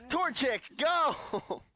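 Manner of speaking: excited command. Real, not acted. Commanding Pokemon in battle.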